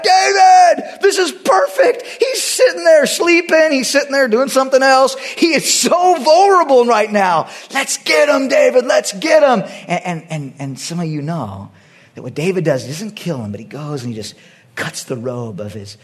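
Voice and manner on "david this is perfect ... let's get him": high, loud eager shouting; acted-out soldier's excited yell; excited urging. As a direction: egg your leader on in an excited shout. Acted excited men urging David on.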